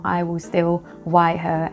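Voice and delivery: usual speaking voice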